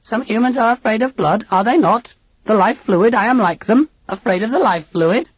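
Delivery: put-on high, stiff alien old lady; robot aunt voice. A narrator's reading. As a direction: speak like a cold machine aunt.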